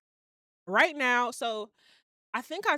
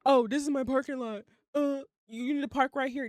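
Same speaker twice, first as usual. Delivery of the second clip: parking attendant voice; 4 semitones higher than usual. Retold or imitated. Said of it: "he was like".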